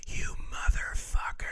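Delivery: creepy whispered curse; menacing whisper. Retold or imitated. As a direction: whisper it right over a sleeper's head. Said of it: "leaned over one of my nephew's heads and I go".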